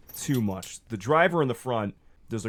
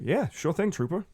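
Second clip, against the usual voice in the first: eager driver; 8 semitones higher than usual. Acted. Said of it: "And Eric looks like".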